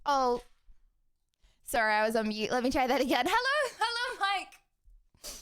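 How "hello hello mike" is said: high singsong hellos; lilting high-pitched welcome; singsong greeting. Real, not acted. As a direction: greet the guest high and drawn out. Repeats greeting after being muted.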